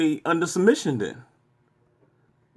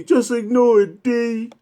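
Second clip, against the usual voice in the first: higher, louder mimicking of the bots; mocking the bots; 7 semitones higher, 4 dB louder, slower than usual. Taunting Brian in the bots' voice, loud and mocking. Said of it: "that's what they telling me".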